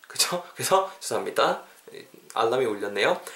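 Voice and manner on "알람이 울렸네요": ordinary delivery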